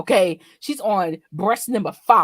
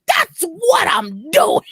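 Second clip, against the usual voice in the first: slow, louder, drawn-out taunt; defiant tease; 9 semitones higher, 8 dB louder, much slower than usual. Retold or imitated. Child teasing mom, drawn out slowly and louder. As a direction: drag it out, daring her.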